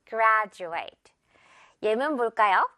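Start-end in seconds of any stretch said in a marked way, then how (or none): 0.1-1.1 "graduate" said GRAD-joo-ate, d turned to j; j sound in graduate. Shown as a demonstration. Teacher modelling "graduate" for the class, j sound. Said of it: "graduate가 되겠죠".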